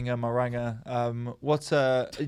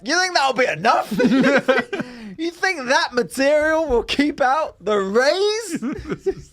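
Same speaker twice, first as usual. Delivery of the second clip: incredulous; 7 semitones higher, slower than usual. Pretend. Acted quote about blinds.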